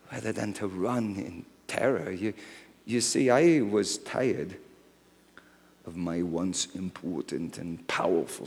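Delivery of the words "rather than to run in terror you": usual speaking voice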